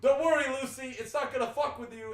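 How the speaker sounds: high, loud calling to a pet; mock loud reassurance. Imitating a man reassuring his cat on the windowsill, loud call. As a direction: holler comfort at the cat.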